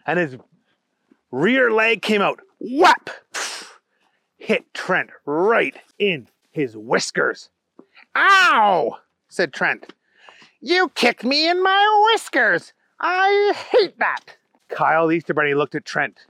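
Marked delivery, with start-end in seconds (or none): 2.6-3.1 sudden high, loud whap; kick sound effect. A narrator's reading. Narrator voices the kick sound. 8.2-9.0 high, loud cartoon yelp; cry of pain. Kicked bunny cries out. 10.6-14.4 very high, whining bunny protest; Trent, hurt and indignant. Acted. Trent complains after kick.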